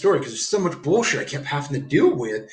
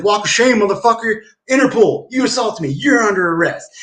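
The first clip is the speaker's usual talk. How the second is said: high, raised-voice challenge; emphatic high-pitched retold command; retold confrontation; 7 semitones higher, 4 dB louder than usual. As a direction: act out triumphantly ordering an arrest. Acts out confronting a policeman.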